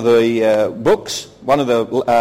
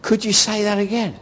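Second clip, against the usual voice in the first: high, soft-voiced mock admiration; imitating smitten women; 7 semitones higher, 4 dB quieter than usual. Pastor imitating women asking to hear his accent again, smitten. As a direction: ask like an enchanted fan, pitched up. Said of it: "women were looking at me like this".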